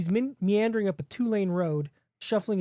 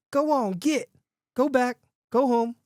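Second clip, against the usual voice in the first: high, raised-voice shooing; loud, high-pitched dismissal; 7 semitones higher, 4 dB louder than usual. A narrator's reading. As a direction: call out short commands to send an animal home. Man shooing the following wolf.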